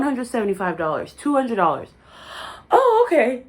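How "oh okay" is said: shocked. Retold or imitated. Shocked at wine prices.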